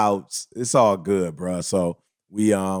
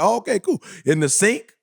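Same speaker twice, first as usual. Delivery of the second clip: naive mock surprise; 9 semitones higher than usual. Retold naive reply.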